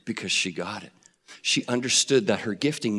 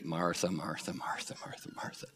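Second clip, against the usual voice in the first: much softer, hushed self-reproach; soft self-chiding; 7 semitones lower, 16 dB quieter than usual. Shown as a demonstration. Preacher shows how he quietly chides himself by name. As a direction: gently scold yourself under your breath. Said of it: "I'm talking to myself".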